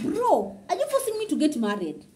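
high-pitched, laughing exasperation; mock protest. Vlogger to nosy followers pushing marriage, mock exasperated.

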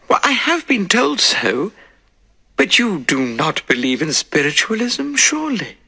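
a hint of a nervous widow; anxious widow. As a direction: play the uneasy lady of the house. Mrs Maltravers voiced by narrator.

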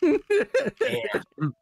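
high, drawn-out shocked damn. Acted. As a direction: react to a shocking surprise. Shocked at cousin.

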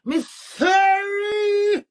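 high, very slow, loud drawn-out sorry; a big hammed-up apology, dragged out; shouted mock sorry. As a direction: stretch the sorry out in a mocking yell.

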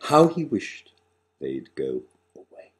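ordinary delivery, nothing marked

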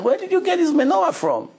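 high, startled asking; astonished question. Retold or imitated. Museum friend stunned, asking where the menorah came from. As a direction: gasp out the question in surprise.

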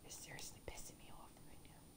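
seething whisper; angry whisper. Real, not acted. Gamer whispering rage at the boss. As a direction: hiss it out under the breath.

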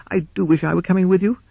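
fast, high toad voice; feigned disappointment. Acted. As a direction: rattle off false regret in a high voice. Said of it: "tried to look disappointed".

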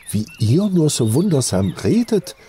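lightly voiced shy girl; a touch of coy embarrassment; bashful. A narrator's reading. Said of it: "sagte das Mädchen verschämt".

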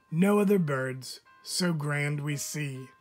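usual speaking voice

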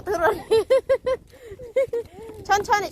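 usual speaking voice

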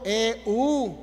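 E U as two distinct vowels; diphthong spelled out. Shown as a demonstration. Teacher answering which is the diphthong, E U distinctly separated. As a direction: sound out E, then U. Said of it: "¿cuál es el diptongo?".

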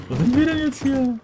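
very high, loud excited question; excited surprise. Real, not acted. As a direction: squeak it out amazed. Bewildered after fight.

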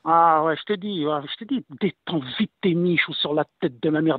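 ordinary talk

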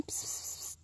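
shooing hiss. Real, not acted. Shooing the dog.

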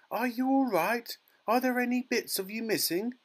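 very high, worried mother; anxious cry. A narrator's reading. Mother checks her tiny son for damage, crying anxiously. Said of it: "cried Missus Teavee".

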